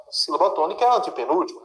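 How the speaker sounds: ordinary talk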